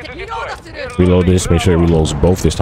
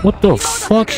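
high, startled squeal of disbelief; startled outburst; slower than usual. Real, not acted. Player caught off guard by sudden attack, startled high yelp.

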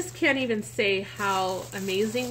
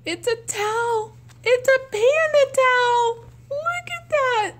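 much higher and louder, near tears; tearful squeal; 13 semitones higher, 7 dB louder than usual. Real, not acted. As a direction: squeal it like you're about to cry with joy. Overjoyed by panda towel.

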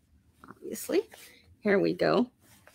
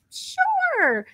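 mock eager yes; 4 dB louder than usual. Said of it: "he's like".

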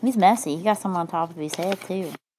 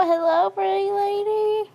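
high, swooning hello; excited greeting. Acted. Mustache Man greets the lady at his door, high and smitten. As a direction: gush over the lady in a high voice. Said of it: "And he says".

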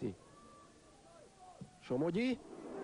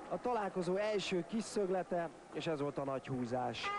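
ordinary talk, nothing marked

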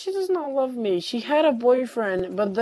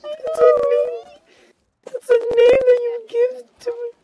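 gushing over cuteness; 12 semitones higher than usual. Real, not acted. S0 reacting to a cute name, high gushing squeal.

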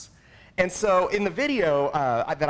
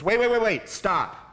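raised, louder mock command to halt; urgent halting; 4 semitones higher, 4 dB louder than usual. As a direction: cut in quickly as a coach calling a halt. Coach halts crying boy, retold.

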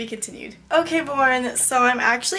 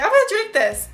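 mock eager; 9 semitones higher, 3 dB louder than usual. Retold plan with milkshake.

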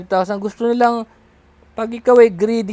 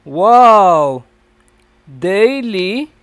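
loud astonished wow, then rising daily; hammed-up gasp of wonder at the pay; mock amazement; 7 dB louder, much slower than usual. Pretending to be the amazed victim, loud mock surprise.

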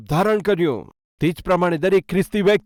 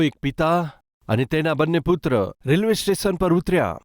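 ordinary delivery

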